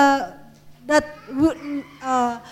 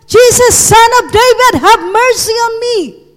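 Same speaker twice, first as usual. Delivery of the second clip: raised, higher-pitched plea; loud cry; 5 semitones higher, 4 dB louder than usual. Acted. Blind beggar shouting to Jesus for mercy, loud crying out. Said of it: "he shouted at loud voice, and he cried out".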